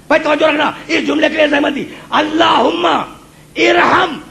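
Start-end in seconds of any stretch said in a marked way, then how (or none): 2.1-4.2 high, slow, stretched-out supplication; ringing prayer recitation. Acted. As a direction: build the prayer to a soaring close. Recites Prophet's raised-hand prayer. (بلند، کھنچی ہوئی دعا)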